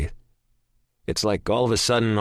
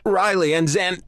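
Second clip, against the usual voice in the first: panicked; 12 semitones higher, 4 dB louder than usual. A narrator's reading. Panicked caller.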